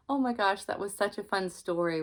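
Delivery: plain reading voice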